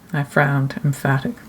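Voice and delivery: ordinary delivery, nothing marked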